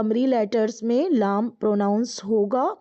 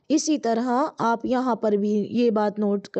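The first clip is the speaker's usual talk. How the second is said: usual speaking voice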